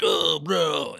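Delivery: high, exaggerated groan of protest; mock whining. Owner mimicking kids' reaction to him, high whiny groan. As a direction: imitate a put-out kid.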